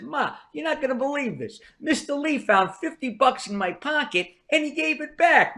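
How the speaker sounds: acted excitement to his mother; excited retelling.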